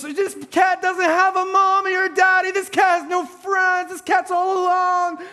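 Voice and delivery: high wailing sob-talk, imitating his little girl; mock crying wail. Dad imitating his three-year-old crying over the sewer cat, high mock wail. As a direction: wail it like a heartbroken toddler.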